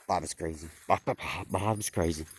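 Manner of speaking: low, soft gossipy murmur; low gossip. Acted. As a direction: pass the rumour along under your breath. Sheep gossip about Bob.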